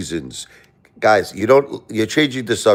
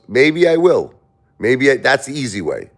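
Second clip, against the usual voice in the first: imitating Trump; 7 semitones higher than usual. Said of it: "He goes".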